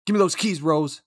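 high, loud movie-quote outburst; acted movie demand. Quoting a scene to a co-host, high loud demand.

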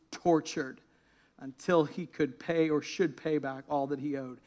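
low, hushed parable reading; scripture reading. A narrator's reading. Preacher reading the parable to the congregation, low and solemn.

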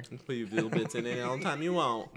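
lightly voiced Southern cook; Paula Deen. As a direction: answer with a hint of a Southern butter lady. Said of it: "You have a great Paula Deen".

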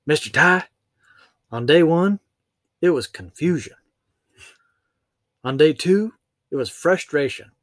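high, loud Southern lady; Southern accent. Retold or imitated. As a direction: quote her with a Southern lilt. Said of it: "she said".

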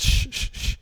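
repeated hushing "shh"; soothing shush. Acted. Kid hugging a yelling man, mock soothing shushes. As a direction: hush him like a fussing child.

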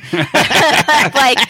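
laughing through the word. Real, not acted. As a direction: giggle it out. Laugh heard.

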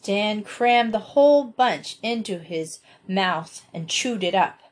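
ordinary delivery, nothing marked

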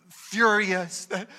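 ordinary delivery

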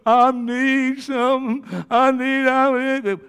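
high, slow fake sobbing; mock crybaby. Preacher mimicking what the world wants men to be, mock crying. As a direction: blubber it haltingly, exaggerating the weakness. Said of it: "tell us that we're crybabies".

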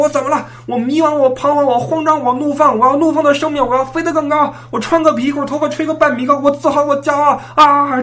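high defiant shouting rant. Retold or imitated. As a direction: belt it out like a rock anthem. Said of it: "羞愤难当啊".

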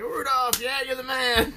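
high, gushing fake praise; mock cheering. Reindeer suddenly praising Rudolph, mock cheering. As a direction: hail him in an exaggerated high cheer.

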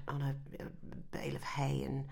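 soft unvoiced whisper; hushed, breathy words; whispered aside. Real, not acted.

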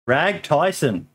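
normal talking voice

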